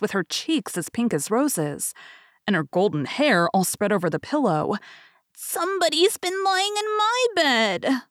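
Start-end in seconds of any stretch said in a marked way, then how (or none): 5.3-8.0 very high, slow little bear squeal; delighted shrill baby bear; joyful baby bear squeak. A narrator's reading. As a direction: squeak it out with joy. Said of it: "squeaked the baby bear joyfully".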